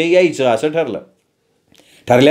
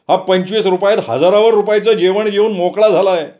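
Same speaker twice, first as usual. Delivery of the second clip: manager voice; 5 semitones higher than usual. Acted. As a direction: complain to the policeman, a touch higher.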